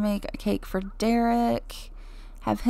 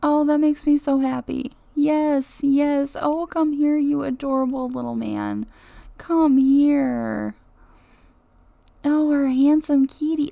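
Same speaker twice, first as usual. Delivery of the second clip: louder, higher pet-talk coo; 4 semitones higher, 5 dB louder than usual. Real, not acted. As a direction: gush over the kitty. Cooing at the pet.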